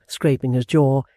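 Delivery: plain reading voice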